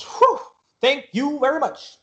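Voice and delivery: high, slow thanks after a puff; puff, then draw out the thanks; relieved ticket seller. Acted. Ticket seller takes the tickets, relieved.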